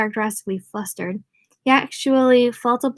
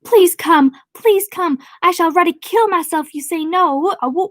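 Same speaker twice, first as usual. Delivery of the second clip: high, louder hammed-up begging; melodramatic plea; 8 semitones higher, 4 dB louder than usual. Richie on his knees begging Bev to come, melodramatic. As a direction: plead like a lovesick fool in a farce.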